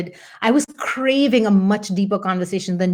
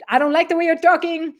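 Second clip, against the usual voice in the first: alarmed husband; 9 semitones higher than usual. Retold or imitated. Quoting Pat's alarmed reaction.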